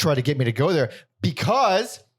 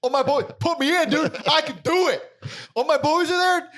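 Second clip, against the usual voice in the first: put-on pleading kid voice; 13 semitones higher than usual. Retold pleading to mom.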